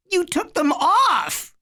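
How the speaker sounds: put-on high, slow childish accusation; a kid crying foul, pitched high; accusing little boy. A narrator's reading. Little Eddie accusing his brother of wrecking the car, high and slow.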